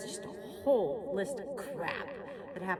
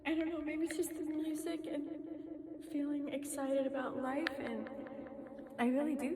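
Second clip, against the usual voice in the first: getting emotional; 6 semitones higher, 5 dB quieter, faster than usual. Real, not acted. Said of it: "I'm getting emotional".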